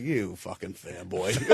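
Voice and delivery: slow, low, soft imitated grumble; muttered imitation. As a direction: mutter it under the breath like an annoyed star. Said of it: "he's just like".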